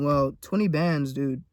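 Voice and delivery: lightly voiced dismissive critic; imitating a dismissive viewer. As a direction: play a shrugging doubter. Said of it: "you're probably thinking".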